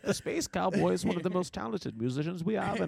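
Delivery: British villain impression; mock-grand British supervillain; British Bane. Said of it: "British, British Bane".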